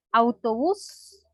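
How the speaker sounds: «autobús» lento, con «bús» alargado y ascendente; acento final. Shown as a demonstration. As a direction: marca la última sílaba de «autobús», estirándola. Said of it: "tiene su acento en la última sílaba". («autobús» con acento agudo en «bús»)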